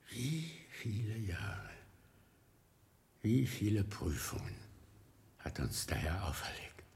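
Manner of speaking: soft whispered confidences; hushed whisper. Acted. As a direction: whisper as if in prayer. Old monk speaks intimately to William. (leises Flüstern)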